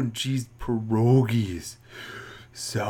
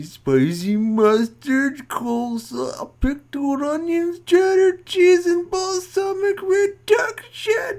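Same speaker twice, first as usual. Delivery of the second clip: voice climbing high; 4 dB louder than usual. Acted. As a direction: pitch rising higher and higher with each item. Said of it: "why is your voice going up so high".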